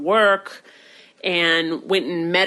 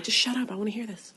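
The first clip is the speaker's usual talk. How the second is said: hushed aside; 10 dB quieter, faster than usual. Retold or imitated. Sister to brother listening in the garage, hushed. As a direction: softly shush someone, retold.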